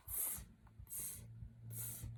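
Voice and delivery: soft whispered monkey tss; monkey hiss. Acted. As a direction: hiss back like a cheeky monkey. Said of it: "stamped their feet back at him and said".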